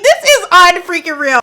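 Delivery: high and loud, half-shrieked disbelief; stunned outrage. Real, not acted. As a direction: sound floored by what you just watched. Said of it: "I'm like".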